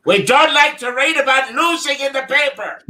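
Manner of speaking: high, hearty priest voice; Father Pat. Acted. Radio priest Father Pat griping about the team, high and hearty. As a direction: play the jolly Notre Dame priest. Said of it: "I was Father Pat from Notre Dame".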